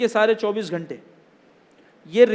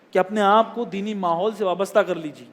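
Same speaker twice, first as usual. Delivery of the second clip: ordinary talk, nothing marked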